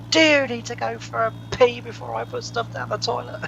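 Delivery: high-pitched imitation of shouting mum; mum screaming. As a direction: mimic your mum yelling through the door. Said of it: "left her coming in screaming".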